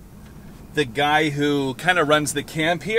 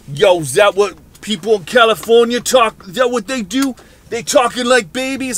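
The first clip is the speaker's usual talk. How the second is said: louder gruff Boston-style wondering; Boston guy talk; 5 semitones higher, 5 dB louder than usual. Retold or imitated. Camp guys wondering about California plates in Boston accent. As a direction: puzzle over it like a tough Boston guy. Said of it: "looked at each other like".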